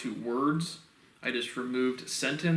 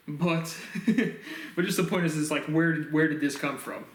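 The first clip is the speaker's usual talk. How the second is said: ordinary talk, nothing marked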